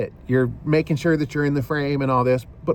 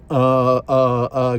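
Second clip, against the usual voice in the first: filler uhs; 4 dB louder, much slower than usual. Shown as a demonstration. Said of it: "giving the uhs out of your speech".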